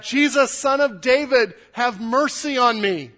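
crying out. Acted. Blind man cries out to Jesus, loud plea. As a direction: call out high and loud for mercy. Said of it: "he cried out".

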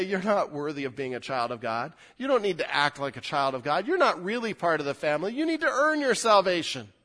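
a hint of a sneering accuser, rising at the end; lightly voiced Satan taunting the believer; accusing Satan. Pastor voices Satan accusing believers, mild mocking taunt. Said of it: "Satan wants to attack and say".

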